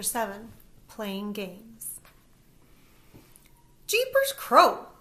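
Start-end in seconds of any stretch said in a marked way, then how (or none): 3.9-4.9 put-on high, slow old lady exclamation; grandma exclaiming. A narrator's reading. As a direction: exclaim like a surprised granny. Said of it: "Grammy says".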